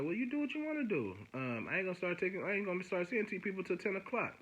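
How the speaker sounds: high-pitched mimic of a brush-off; imitated dismissive officer. Probation officer brushing off his complaint, high mocking imitation.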